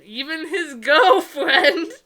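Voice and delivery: laughing outrage at the plot; incredulous. Real, not acted. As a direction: shriek the word in disbelief. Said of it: "laughing disbelief at the plot".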